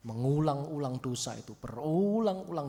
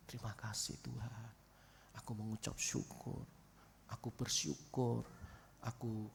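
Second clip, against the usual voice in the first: soft prayer; 4 semitones lower, 10 dB quieter than usual. Shown as a demonstration. Quiet grateful prayer example.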